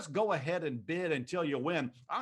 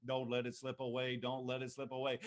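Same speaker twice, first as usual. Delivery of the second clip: quiet urging; 3 semitones lower, 5 dB quieter than usual. Real, not acted.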